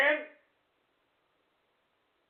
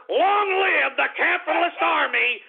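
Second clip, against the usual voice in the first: high, rousing sign-off; exultant catchphrase call; rallying cry; 5 semitones higher than usual. Real, not acted. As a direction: proclaim it like a battle slogan.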